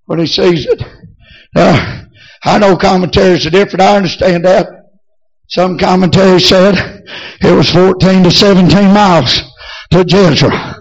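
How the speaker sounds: ordinary talk, nothing marked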